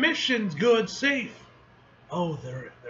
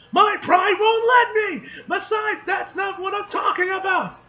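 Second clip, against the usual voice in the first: indignant shout; 13 semitones higher, 6 dB louder than usual. Acted. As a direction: yell it, wounded pride. Character protests loudly in dialogue.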